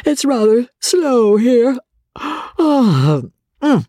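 bored, through a yawn. A narrator's reading. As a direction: stretch the words in a yawn. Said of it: "Here George Osborne yawned".

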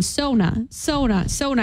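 "Sona" with a long O, over and over; repeated mispronunciation. Retold or imitated. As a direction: imitate someone insisting on "Sona", pitch climbing. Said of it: "just kept saying".

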